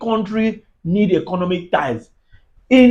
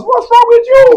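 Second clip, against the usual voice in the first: much higher, louder exclamation; exclaimed; 13 semitones higher, 5 dB louder than usual. Real, not acted. Mocking the commenter's club habits, high loud exclamation. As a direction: throw the question out in disbelief. Mock outrage, then laughs.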